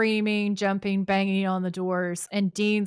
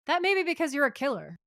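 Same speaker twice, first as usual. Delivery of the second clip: higher, fast mock-sarcastic comeback; sarcastic retort; 8 semitones higher, faster than usual. Sam snarking at the killer, mock sarcastic. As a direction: toss it off with cheeky disbelief.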